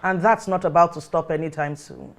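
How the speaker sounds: ordinary delivery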